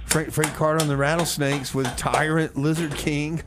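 ordinary talk

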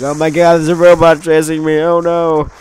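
hammed-up scared cry of a fleeing sniper; mock panic. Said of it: "He's like".

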